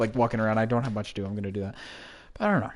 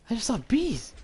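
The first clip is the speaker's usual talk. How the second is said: high-pitched, bewildered insistence; startled disbelief; 11 semitones higher than usual. Real, not acted. Still startled by the bees.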